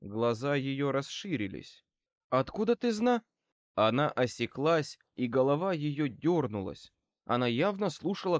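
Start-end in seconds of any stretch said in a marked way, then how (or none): 2.3-3.2 sharp high startled question, cut off; blurting it out before catching herself. Acted. Trixie, caught off guard by the wizard, startled.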